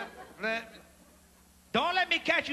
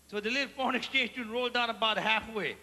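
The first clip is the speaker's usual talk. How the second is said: normal talking voice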